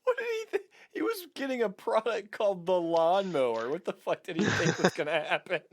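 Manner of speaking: high, laughing incredulous outburst; cracking up while asking in disbelief. Real, not acted. Cohost laughing in disbelief at the bloody review.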